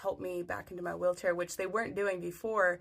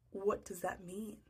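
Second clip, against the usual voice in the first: slow, hushed bewilderment; baffled; 7 dB quieter, slower than usual. Retold or imitated. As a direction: ask slowly and softly, utterly lost. Baffled reply to the cryptic nurse.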